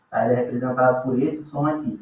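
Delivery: ordinary talk, nothing marked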